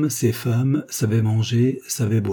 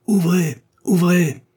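much higher, louder hoarse cry; hoarse shout; 10 semitones higher, 6 dB louder, slower than usual. Acted. Soldiers at the garden gate, a hoarse loud shout. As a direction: bang out Ouvrez like a soldier at the gate. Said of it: "Une voix rauque cria".

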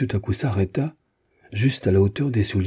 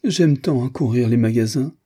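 high, plaintive entreaty; pleading; 6 semitones higher, 3 dB louder than usual. A narrator's reading. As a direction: beg like a poor meek creature. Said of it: "avec l'accent de la prière". (ton de prière plaintive)

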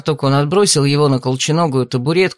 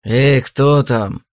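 harsh barking commands; much slower than usual. A narrator's reading. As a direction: bark it like a big blacksmith. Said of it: "рявкнул он".

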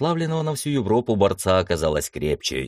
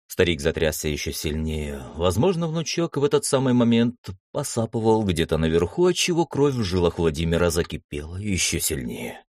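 usual speaking voice

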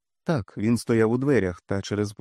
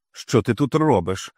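slow, louder snap; barking; 4 dB louder than usual. A narrator's reading. Wife snapping at her husband, slow and loud. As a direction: bark it at him. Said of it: "гаркнула Карла".

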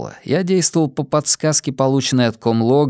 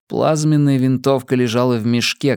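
ordinary delivery, nothing marked

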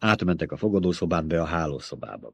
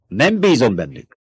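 snapping; 3 semitones higher, 5 dB louder than usual. A narrator's reading. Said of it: "csattant fel a báró".

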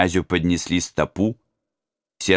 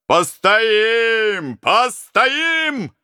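high, loud crowd cry; shouting in unison; 4 dB louder, much slower than usual. Acted. All the robbers to the prince, high loud shout in unison. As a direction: roar it together like a war cry. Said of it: "закричали все разбойники в один голос".

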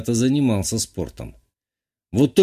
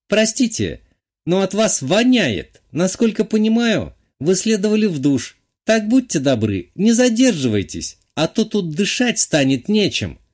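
haughty lady voice, much higher; imperious plummy grande dame; 8 semitones higher than usual. A narrator's reading. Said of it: "надменно произнесла". (надменная светская дама)

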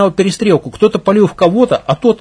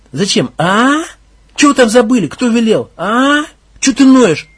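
raised voice rising to an angry shout; growing fury; 4 semitones higher, 4 dB louder than usual. Acted. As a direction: build from suspicion into rage. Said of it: "Внезапно разъярился он".

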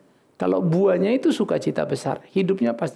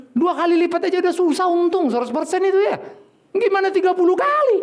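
incredulous mock; 11 semitones higher than usual. Mocking prosperity-gospel math.

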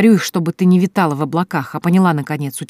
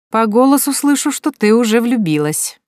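higher, louder knowing tease; a friend teasing Sonya about being in love, higher and louder; teasing declaration; 7 semitones higher, 5 dB louder than usual. A narrator's reading. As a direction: announce it like you caught her out. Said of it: "заявила Вика".

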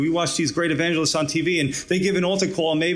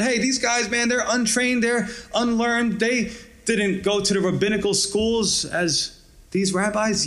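higher, lightly voiced townsfolk marvelling; mock amazed onlookers; 7 semitones higher than usual. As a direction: play the astonished crowd, pitched up.

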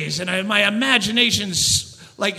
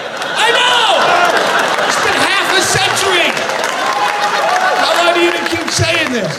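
high, loud, fast fed-up shout; mock outrage at the bus announcement; exasperated yell; 7 semitones higher, 4 dB louder than usual. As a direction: blast it back at the announcer. Yelling back at the announcement.

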